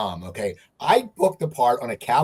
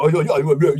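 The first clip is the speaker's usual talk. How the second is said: put-on Cockney accent; 6 dB louder, faster than usual. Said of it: "the stupid Cockney accent".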